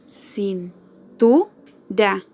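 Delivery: "Cintura" very slow, cin - TU - ra, TU lifted; syllable by syllable. Shown as a demonstration. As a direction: separate "Cintura" into three syllables, stressing TU. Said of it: "tiene su acento en la penúltima sílaba". ("Cintura" por sílabas, con pausas, acento en TU)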